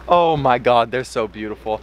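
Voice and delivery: ordinary delivery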